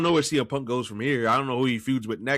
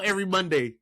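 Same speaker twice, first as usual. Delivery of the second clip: usual speaking voice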